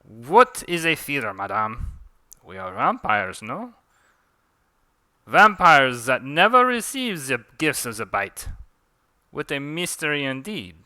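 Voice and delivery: put-on theatrical French-accented vampire; French accent with swinging emphasis. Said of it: "Forgive my French accent".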